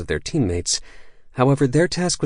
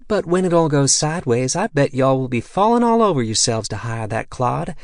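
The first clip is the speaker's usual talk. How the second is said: higher, playful y'all voice; 6 semitones higher than usual. A narrator's reading. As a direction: tease him with a put-on southern lilt. Mindy teasing the dwarf.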